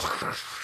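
soft, unvoiced hissing lighter noise; mouth-made lighter whoosh; imitated lighter sound. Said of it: "a lighter can go like".

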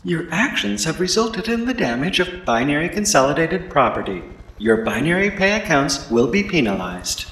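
computerized security drone voice; cold machine announcement; robot voice. Acted. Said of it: "a computerized voice".

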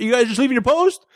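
high-pitched baffled question; mock disbelief. Man left standing as guards drive off, mock disbelief. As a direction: act out a bystander who can't believe it.

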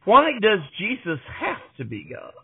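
usual speaking voice

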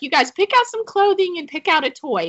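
high-pitched, retold excited mom voice; excited announcement. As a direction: announce a treat to the kids. Mother announcing treat to excited boys.

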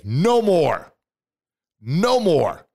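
loud, high, forceful refusal. Shown as a demonstration. Motivational speaker shouting at his doubts, loud and high. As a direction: belt it out like slamming a door. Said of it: "And I want you to say".

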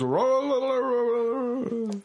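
high, loud rowdy rah chant; crowd cheering. Retold or imitated. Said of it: "everyone goes".

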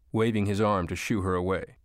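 plain reading voice

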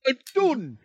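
hammed-up Scottish accent; put-on Scottish voice. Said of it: "in the Scottish language".

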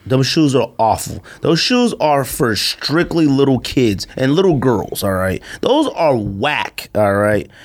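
ordinary talk, nothing marked

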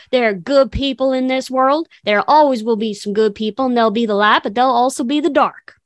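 sermon-like Southern twang; Southern accent. Retold or imitated. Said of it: "Where'd your Southern accent come from?".

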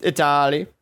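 normal talking voice

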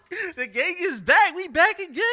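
hyped-up, loud and high; excited. Real, not acted. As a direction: announce it like a big return. Hosts hyping the return.

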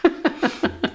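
a short breathy giggle, held back; swallowed laugh; stifled giggles. Real, not acted. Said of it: "No te rías".

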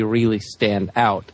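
ordinary delivery

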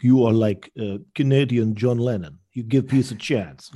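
a hint of Ilya's Russian English, eager; light Russian accent. Fixer praising him as a protest hero, light Russian accent.

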